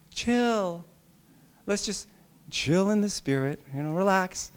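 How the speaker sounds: quiet, soft, laid-back reassurance; soothing. Acted. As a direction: softly talk someone down. Calming himself after mock outburst.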